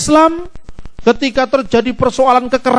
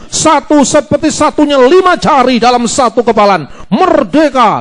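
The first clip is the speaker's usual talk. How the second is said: rousing oratory, climbing to a higher pitch; sermon intonation; 3 semitones higher than usual. Shown as a demonstration. Preacher rallying a diverse nation to be one, rousing sermon intonation. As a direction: preach it with swelling force up to a rallying cry. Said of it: "Kan intonasinya sudah intonasi khotbah".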